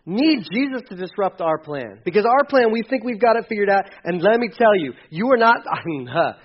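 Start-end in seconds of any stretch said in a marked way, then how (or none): none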